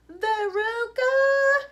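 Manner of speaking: high, loud, drawn-out call; long high shout of the name. A narrator's reading. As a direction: call down a hole to your child. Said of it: "shouted Missus Salt".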